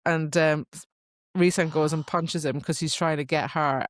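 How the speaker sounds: normal talking voice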